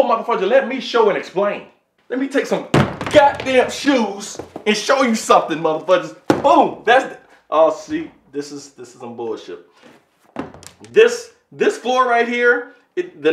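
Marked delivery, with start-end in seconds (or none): none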